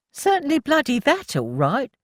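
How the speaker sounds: high exasperated huff. A narrator's reading. Milly grumbling to Annie about the digging, high huff. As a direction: huff it out with irritation. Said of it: "Milly huffed".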